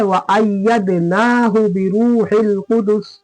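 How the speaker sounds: slow, measured chant with held long vowels; Quran recitation. Shown as a demonstration. Teacher reciting the verse for students, slow measured chant. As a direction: recite slowly and evenly, drawing out long syllables.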